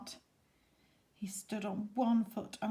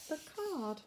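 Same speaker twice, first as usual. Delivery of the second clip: plain reading voice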